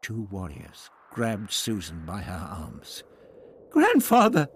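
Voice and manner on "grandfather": high, loud cry for help; calling out. A narrator's reading. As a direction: call out sharply to grandfather. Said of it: "she called out".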